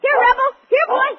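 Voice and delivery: loud high call across the room; calling the dog. Acted. Boy calls his dog over, loud. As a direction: shout for the dog to come.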